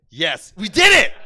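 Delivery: loud, slow victory cry; triumphant shout. Pretend. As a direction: cheer it like a win.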